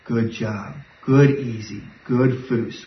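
low, soft praise to the dog; low gentle good-dog praise. Shown as a demonstration. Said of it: "notice the tone of my voice is very different there".

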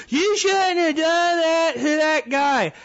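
high, slow, mock-hurt whine; slow mock complaint from offended listeners; mocking whiny listener. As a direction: whine it like a scolding fan, drawn out. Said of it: "I know I'm gonna hear from some of you guys".

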